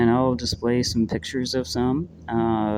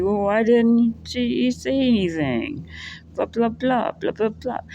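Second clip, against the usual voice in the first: mock whiny girl; 12 semitones higher than usual. Isla voiced, mocking.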